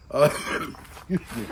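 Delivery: coughing and choking; choking on a drink. Real, not acted. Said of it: "choking myself".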